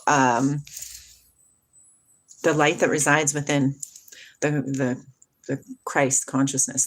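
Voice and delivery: ordinary delivery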